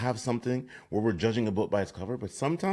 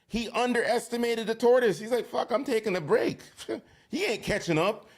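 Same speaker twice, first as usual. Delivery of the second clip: high-pitched, mocking storyteller voice; cocky hare. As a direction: play the overconfident hare way up high. Tells the hare's part, acted.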